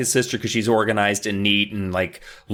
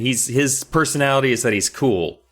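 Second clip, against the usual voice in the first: ordinary talk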